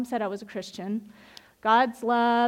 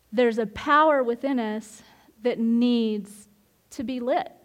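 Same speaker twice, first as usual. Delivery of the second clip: ordinary delivery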